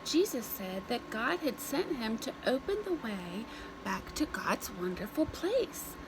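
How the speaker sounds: ordinary delivery, nothing marked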